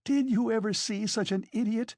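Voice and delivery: high-pitched derisive outburst; worked-up, scornful woman; hysterical scorn. A narrator's reading. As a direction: appeal to the room in agitation. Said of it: "broke into hysterical laughter".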